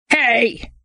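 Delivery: sharp high hey; shout. A narrator's reading. Jittery leader shouting at his partner. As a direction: snap it at the man in back. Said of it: "shouted Jittery Guy again".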